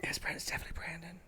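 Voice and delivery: soft breathy whisper. Real, not acted. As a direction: hush it right down. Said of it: "We can talk really soft".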